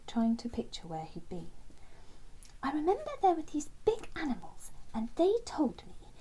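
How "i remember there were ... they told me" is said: higher, searching character voice; piecing it together, pitched up; Terence recalling. Acted. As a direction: reach for the memory as you speak. Terence recalls big animals.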